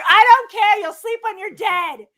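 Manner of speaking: mock yell. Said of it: "I'm like".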